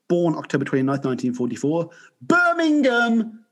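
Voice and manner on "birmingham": proud. Real, not acted. Proud of home city.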